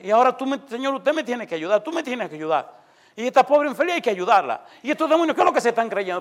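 high, worked-up insistence to God; retold indignant demand. Young preacher demands God help the woman, retold indignation. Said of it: "después del pleito con el Señor".

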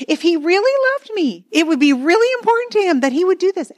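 high, aggrieved self-talk rising in pitch; indignant inner voice. Retold or imitated. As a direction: play the wounded spouse fuming to herself. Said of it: "It goes something like this".

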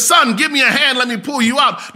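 father pleading up to a trapped son; urgent plea. Retold or imitated. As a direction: push the plea harder this time. Father urging son more strongly.

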